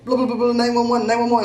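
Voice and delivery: mock Indian accent for the dentist's words. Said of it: "heard him talking with his Indian accent".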